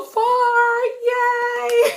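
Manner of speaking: high sung "Yay" into a giggle; playful high squeal and laughter; silly squeal. Real, not acted. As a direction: squeal and laugh at your own silliness. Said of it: "I'm being really silly".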